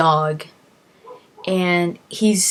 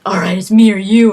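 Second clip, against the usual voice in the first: challenge; 3 semitones higher, 10 dB louder than usual. Retold or imitated. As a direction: throw down the challenge. Guy declares the fight.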